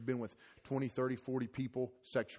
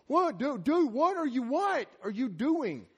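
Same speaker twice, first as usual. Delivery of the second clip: exasperated dad; 12 semitones higher, 6 dB louder, slower than usual. Retold or imitated. Dad woken by crib rocking.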